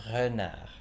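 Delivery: renard with a very slight throaty R; French pronunciation. Shown as a demonstration. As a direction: say renard, R almost silent. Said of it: "you say".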